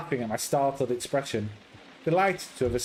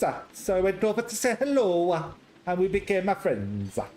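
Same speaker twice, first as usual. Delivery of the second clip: put-on chatty, higher Italian accent; 5 semitones higher than usual. Patrizia telling how she met Ulysses, chatty Italian accent. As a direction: gossip in a friendly Italian lilt.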